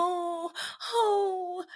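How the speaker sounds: high, crying mock moan; mock suffering moan; whiny sick groan. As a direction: ham up a sick man's whimper. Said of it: "they be dragging it".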